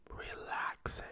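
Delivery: soft breathy whisper of relief. Real, not acted. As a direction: whisper it as if melting into calm. Describing Fireball's relaxing feel.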